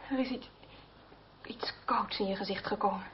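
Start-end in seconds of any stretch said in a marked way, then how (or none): 1.4-3.1 breathy hush, almost no voice; low confiding whisper. Acted. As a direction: let it slip out in a whisper. Hushed intimate remark.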